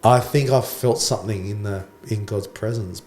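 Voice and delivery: ordinary delivery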